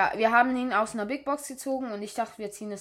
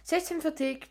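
ordinary talk

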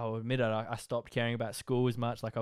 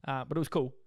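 ordinary delivery

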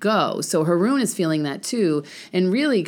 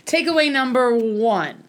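higher hype voice; announcer voice; 8 semitones higher, 3 dB louder than usual. Pretend. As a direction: announce it playfully. Segment announcer voice.

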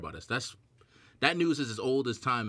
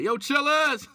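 higher, loud hail across the street; calling out; 7 dB louder, slower than usual. Pretend. Imagined listener spotting the host, calling out loud. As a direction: shout his name like an excited fan. Imagined fan shouting at podcaster.

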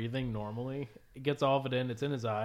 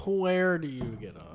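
mock outrage; 6 semitones higher, 6 dB louder, slower than usual. Echoes line 211 in mock drama.

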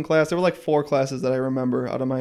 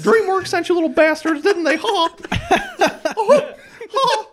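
Mickey Mouse. Retold or imitated. Quoting South Park's Mickey to friends, a high squeaky voice. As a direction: do a high-pitched giggly cartoon mouse. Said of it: "every time Mickey Mouse talks".

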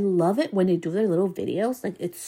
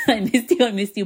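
higher and louder, laughing through it; laughing fondly; 4 semitones higher, 4 dB louder than usual. Real, not acted. Telling her recovered voice she missed it, with a laugh. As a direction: laugh as you say it to your own voice.

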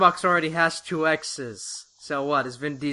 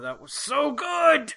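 excited gush; 11 semitones higher, slower than usual. Real, not acted. Gushing about the crossover.

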